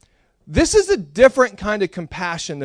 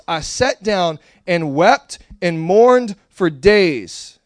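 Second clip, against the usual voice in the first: scripture reading; 3 semitones higher, 4 dB louder, slower than usual. Acted. Reads Nehemiah scripture aloud.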